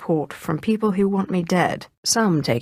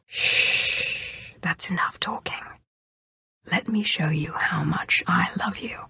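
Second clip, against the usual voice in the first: hushed, softer whisper close to the ear; intimate whisper; 4 dB quieter, slower than usual. Acted. Sith lover hushing a nervous partner, whispered. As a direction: hush them gently, then whisper the promise.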